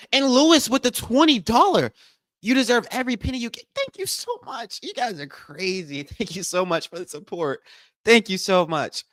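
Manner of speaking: high, excited thank-you for the donation; giddy donor shout-out; squealed thanks. Real, not acted. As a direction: gush your thanks up high.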